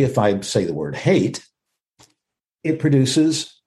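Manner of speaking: ordinary talk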